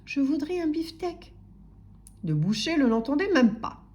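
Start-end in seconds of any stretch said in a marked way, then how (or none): 0.1-1.3 small, higher, timid voice; Monsieur Silence. A narrator's reading. Timid customer to singing butcher, meek. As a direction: plead meekly for attention. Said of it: "murmurait Monsieur Silence".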